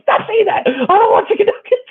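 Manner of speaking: whiny protest. Retold or imitated. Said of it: "I'm like".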